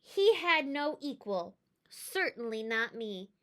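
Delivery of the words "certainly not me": plain reading voice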